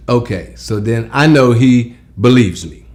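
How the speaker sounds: normal talking voice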